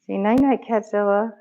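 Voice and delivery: night-night up high, rising; cooing at the cat; high pet voice. Real, not acted. Talking to cat at bedtime.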